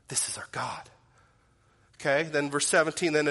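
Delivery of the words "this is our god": unvoiced reverent whisper; hushed whisper. Real, not acted. Preacher hushes on God's power.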